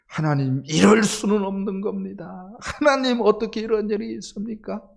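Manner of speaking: indignant outburst of complaint; complaining prayer. Retold or imitated. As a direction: rail at God, indignant and aggrieved. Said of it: "원망하고 불평하고 ... 퍼붓듯이".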